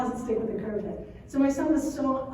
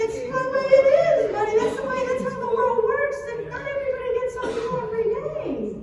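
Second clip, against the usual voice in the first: much higher, louder matter-of-fact parent; firm mom; 12 semitones higher, 4 dB louder, faster than usual. Retold or imitated. Mom telling her son how the world works, higher. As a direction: lay down the truth to him. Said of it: "And I'm like".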